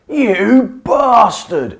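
slow, high, loud bellow. Acted. Barry bellowing at the Blob before punching it. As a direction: bellow it at the monster. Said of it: "he bellowed".

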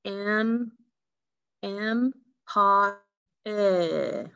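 "M, M, pa, eh" in separate held pieces; sounding out. Shown as a demonstration. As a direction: stretch each piece of "M, M, pa, eh" with gaps. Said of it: "what is the third sound".

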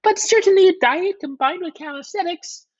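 very high, put-on official voice; high-pitched, careful diplomat; diplomatic secretary of state. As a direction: tiptoe tactfully in a piping voice. Secretary of state advises diet.

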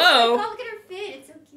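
high, loud excited coo. Real, not acted. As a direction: gush over her outfit. Cooing at a cute outfit.

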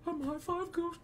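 airy, very high ghost voice; high-pitched cartoon ghost; High Five Ghost. Shown as a demonstration. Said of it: "It's kinda airy".

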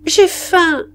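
put-on high-pitched wail of a waking child, lightly projected; child's cry. A narrator's reading. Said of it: "se réveillèrent et crièrent".

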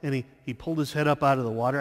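ordinary talk, nothing marked

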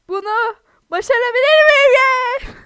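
triumphant whoop; excited cry. Real, not acted. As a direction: yell it out as you make the jump. Big jump attempt then success.

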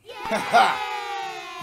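short hearty laugh; burst of laughter. Real, not acted. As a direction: laugh out at the title. Laughs after reading song title.

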